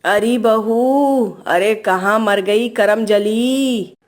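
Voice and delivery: very slow, drawn-out scolding call; yelling mother-in-law. Acted. Mother-in-law summons her daughter-in-law, yelling in long drawn-out words. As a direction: stretch the words out, calling the bahu. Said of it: "इतना क्यों चिल्ला रही हैं".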